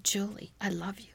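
a hushed voice waking a sleeper; soft inner voice. Retold or imitated. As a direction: say it gently, almost under the breath. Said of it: "that voice got louder and louder".

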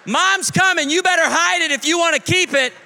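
urgent high-pitched shout; yelling. Retold or imitated. Dad warning the children mom is decluttering, yelling. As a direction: holler a warning through the house. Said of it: "yelling to the children".

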